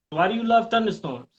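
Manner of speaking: usual speaking voice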